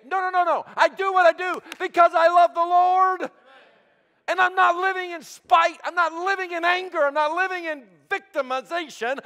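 loud, high, ringing preacher's cry; full-force preaching. Real, not acted. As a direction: build to an impassioned declaration over the congregation.

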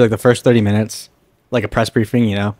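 normal talking voice

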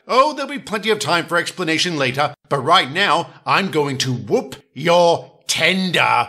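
smug threat. Acted. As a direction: rattle off then savor the threat. Edward threatens Gordon.

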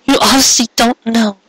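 high, trembling tearful voice. Acted. Cupcake to Carrot, high and close to tears. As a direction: hold back tears as she speaks. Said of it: "tears gathered at the ends of her eyes".

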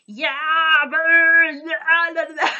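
loud, high nonsense hollering; mock yelling gibberish. Mocking YouTubers who yell for nothing, loud high gibberish. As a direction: ham up a YouTuber yelling for no reason. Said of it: "they yell for the sake of yelling".

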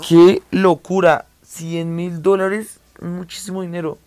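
drawn-out, loud astonishment; slow and loud, in disbelief; stunned. Real, not acted. Amazed at the price.

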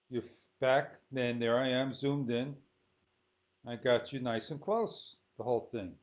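usual speaking voice

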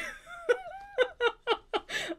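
high laugh cracking into a sob; teary laugh. Real, not acted. Reader overjoyed by the book, laughing near tears. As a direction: laugh until it nearly turns to crying.

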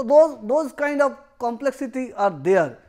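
normal talking voice

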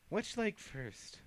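high, slow and mocking; slow cruel tease. Lucy taunting the beaten hag, high and slow. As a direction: savour the threat like a game. Lucy toying with her victim.

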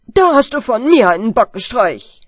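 loud threatening doctor; angry doctor. Acted. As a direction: threaten the boy loudly. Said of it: "sagt der Doktor".

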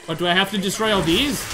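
normal talking voice

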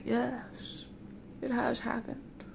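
slow, hushed, half-whispered reveal; ominous confirmation. Retold or imitated. Other guy confirming the rumor, slow and hushed. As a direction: imitate a grave soothsayer, softly.

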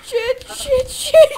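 panicked cursing. Real, not acted. Zombie attack, panicking.

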